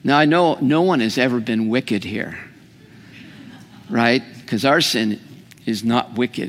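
ordinary talk, nothing marked

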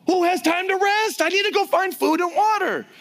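high, loud, frantic complaint; anxious objector. Acted. A worried Israelite protests about resting, high and loud. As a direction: blurt it out like someone in a panic.

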